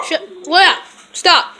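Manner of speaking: firm scold at the dog. Real, not acted. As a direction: tell the dog off firmly.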